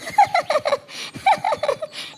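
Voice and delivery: put-on high childish laugh; high giggle; child's giggle. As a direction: burst out giggling. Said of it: "Kuku हंसने लग जाता है".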